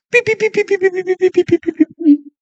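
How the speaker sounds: high squeaky mock whine of sulking; whiny high mock beeping. Imitating whiny sulking noise. (gimoteo agudo de burla, como niño que hace berrinche)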